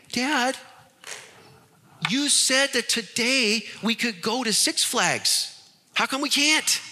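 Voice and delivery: put-on disappointed kids. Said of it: "they just start to cry. And they say".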